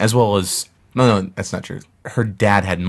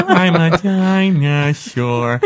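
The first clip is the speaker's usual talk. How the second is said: slow, higher, laughing cartoon voice; Peter Griffin imitation; 9 semitones higher, 3 dB louder, much slower than usual. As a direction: do the dinosaur line as Peter Griffin. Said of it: "Probably Peter Griffin".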